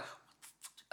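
soft, muttered mock outrage; furious under breath. Daughter reacting beside her happy mom, furious but hushed. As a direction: retell a stifled angry curse. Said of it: "Like, she, like, furious".

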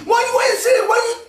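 high, loud mock wife with a laugh; hammed-up wife scolding; nagging wife. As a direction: mimic the wife, shrill and loud. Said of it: "you see".

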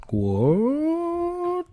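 high, long, stretched "What?"; cartoonish disbelief at all the gods; mock shocked what. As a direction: pull the word way up in fake shock. Jason's shocked reaction retold.

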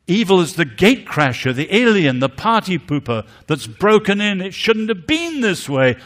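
loud, rising, fervent sermon delivery; impassioned preaching. Acted. Preacher denouncing evil to the congregation: loud fervent preaching. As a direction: thunder it from the pulpit.

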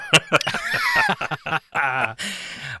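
a loud, high burst of laughter; hearty laugh. Real, not acted. Laughing at listener's comment.

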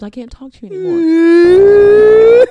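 loud, high, slow fake wail; mock wailing. Neglected sister wailing at her sister, hammed-up loud cry.

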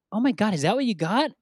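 mock disbelief, high and rising to a question; incredulous classmate. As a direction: act out an appalled classmate. Classmate reacting to a bad gift.

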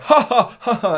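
high mock-sarcastic ha ha; sarcastic laugh. Retelling rude cinema viewers, sarcastic laughing. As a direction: scoff it like a smug teenager. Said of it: "laughing sarcastically".

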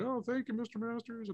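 a hint of a grateful kid; mock thank-you note. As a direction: read the plaque in a sweet, high little voice. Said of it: "the scouts saying".

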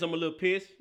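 ordinary delivery

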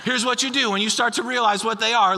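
usual speaking voice

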